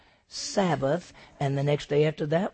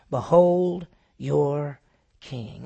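slow presentation; 5 dB louder, much slower than usual. Acted. Pilate presents beaten Jesus.

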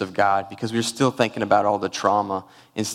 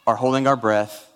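normal talking voice

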